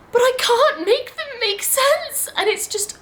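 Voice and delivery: high-pitched wail of frustration; exasperated outburst. Real, not acted. Reader venting about baffling italics, exasperated high wail. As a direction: cry out, baffled and at wits' end.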